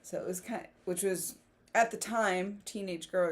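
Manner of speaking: normal talking voice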